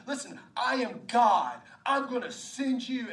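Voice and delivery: emphatic, commanding voice of God; God speaking. Acted. God answering Moses' doubts, emphatic and commanding. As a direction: declare it with authority, loud on the key words. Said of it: "God's saying".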